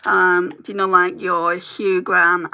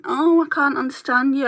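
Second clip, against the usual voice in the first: higher-pitched imitation of a complainer; mock complaint; 5 semitones higher than usual. Vlogger quoting viewers who complain about the accent, mock complaint. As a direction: lightly mimic a puzzled viewer.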